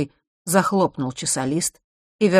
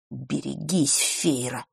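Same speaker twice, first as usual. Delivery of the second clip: whispered threat; 6 dB quieter, slower than usual. A narrator's reading. Boy with narrowed eyes warns Feira, hushed threat. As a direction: warn her quietly, under the breath. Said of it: "прошептал он".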